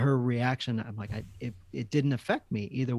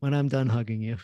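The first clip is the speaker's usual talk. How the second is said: calm dad; 3 dB louder than usual. Retold or imitated. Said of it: "I just grab him".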